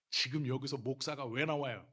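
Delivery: loud mock-outraged comeback; retold indignant retort. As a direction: re-enact firing back at an accuser. Retells his angry comeback, laughing after.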